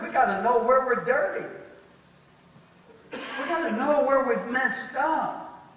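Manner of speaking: ordinary talk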